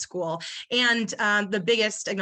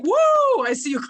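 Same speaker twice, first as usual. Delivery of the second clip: high, loud mock cheer "Woo"; hype cheer; 9 dB louder than usual. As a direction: hype them up like a fan. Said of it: "Maureen's like".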